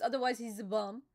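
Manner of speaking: ordinary talk, nothing marked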